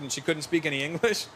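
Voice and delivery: usual speaking voice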